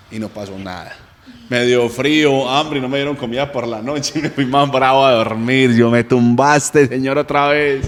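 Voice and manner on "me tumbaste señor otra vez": laughing complaint. Retold or imitated. Laughing complaint to God, laughs around.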